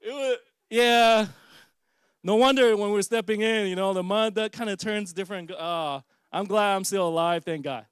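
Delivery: loud amused telling; laughing while talking. Real, not acted. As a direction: talk on while still cracking up. Still laughing after the punchline.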